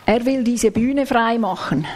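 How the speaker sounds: ordinary delivery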